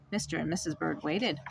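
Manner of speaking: plain reading voice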